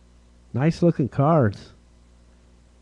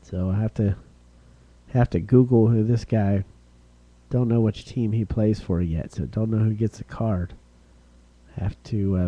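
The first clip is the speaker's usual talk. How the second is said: normal talking voice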